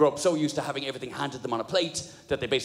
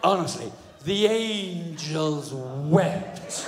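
very slow mock awe; imitated, drawn-out sarcastic reverence; sarcastic wife; much slower than usual. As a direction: drag out the fake praise as the wife. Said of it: "the most sarcastic woman on the planet".

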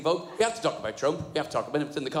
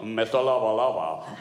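low, smooth would-be seducer; slick; 5 semitones lower, 3 dB louder than usual. Retold or imitated. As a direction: play at being slick, pitched down. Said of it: "I'm being all slick".

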